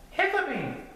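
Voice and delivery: high-pitched little cub; high storybook cub; Little Bear character voice. A narrator's reading. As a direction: answer high and small like a young bear.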